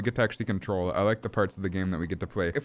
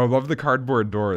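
ordinary delivery

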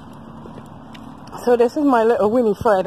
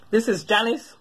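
normal talking voice

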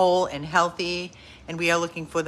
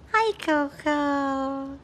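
higher, drawn-out hi to the dog; 7 semitones higher, much slower than usual. Real, not acted. Owner greeting her dog in a slow high coo. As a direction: coo it slowly like to a puppy.

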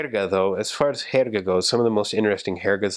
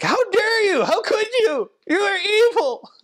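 shrill falsetto mock horror, far above the usual voice; mock outrage; 3 dB louder, slower than usual. Horrified listeners scolding the guinea pig eater, mock outraged squeal. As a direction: squeal it like a scandalised pet lover. Mimics horrified pet lovers' reaction.

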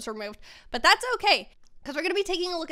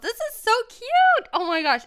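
excited squeal; 11 semitones higher, 8 dB louder than usual. Real, not acted. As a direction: squeal it out with delight. Gushing over new hairstyles.

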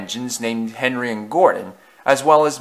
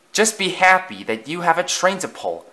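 hissed; 6 semitones higher than usual. A narrator's reading. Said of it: "Thomas hissed".

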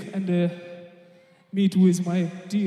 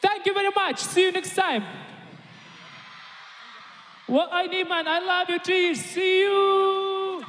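calling out to crowd; 10 semitones higher than usual. Real, not acted. Singer farewell shouted to fans.

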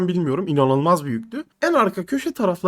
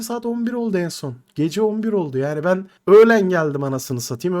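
ordinary delivery

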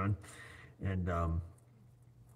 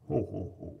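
Green Giant ho ho ho. Retold or imitated. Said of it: "I sounded like the Green Giant".

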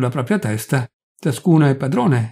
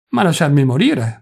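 ordinary delivery

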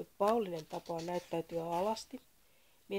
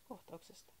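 ordinary delivery, nothing marked

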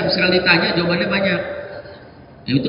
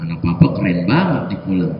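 lower, easy mock praise; dad's praise. Father praising his teen in one short sentence. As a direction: praise the kid offhandedly. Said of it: "cukup sepotong kalimat".

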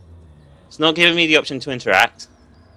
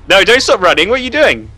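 loud, high frantic yelling; fleeing, yelling in panic; panicked yelling; 6 semitones higher, 5 dB louder, faster than usual. Real, not acted. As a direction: yell it in panic. Panicked flight.

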